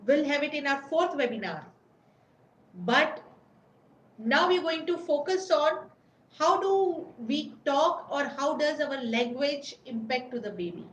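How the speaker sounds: ordinary talk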